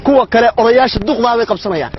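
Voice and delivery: ordinary delivery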